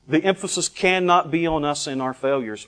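ordinary delivery

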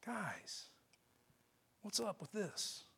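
soft, gentle near-whisper to friends; unfrustrated. Acted. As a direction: ask quietly, with no reproach. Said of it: "not with any frustration".

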